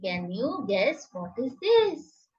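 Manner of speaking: normal talking voice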